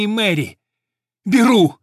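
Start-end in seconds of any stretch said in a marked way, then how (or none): 1.3-1.8 loud, high, urgent cry; shouting. A narrator's reading. Old jockey seizing the horse, shouting out. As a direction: burst out grabbing the chance. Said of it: "закричал он".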